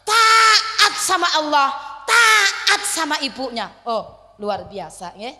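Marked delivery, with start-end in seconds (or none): 0.1-3.7 Taat drawn out loud and high; stress on taat. Acted. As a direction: hammer Taat slowly both times. Preacher stressing obedience. (tekankan kata Taat, keras dan panjang)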